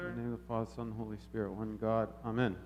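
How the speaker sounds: soft, low opening prayer; quiet invocation. Acted. Priest opening the sermon with a soft, low blessing.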